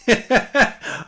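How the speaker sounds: high, loud burst of laughter; delighted laugh. Real, not acted. Pleased by a fan's Spider-Man sketch, delighted laughing. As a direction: laugh out loud, really tickled.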